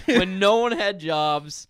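laughing. Real, not acted. Laughing mock outrage.

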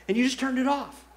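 ordinary talk

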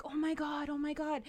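put-on a kid raising the alarm to everyone; hammed-up childish alarm; dramatic child panic. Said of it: "I was a very dramatic child".

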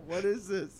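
ordinary delivery

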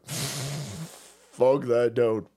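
very slow stretched repeat; drawn-out repeat. Shown as a demonstration. Repeating his curse at LeVar Burton, very slow and drawn out. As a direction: drag every word of it out.

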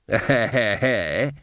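rhythmic sly snicker; put-on chuckle. A narrator's reading. Third man eggs Granny on, chuckling. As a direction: laugh in character after goading.

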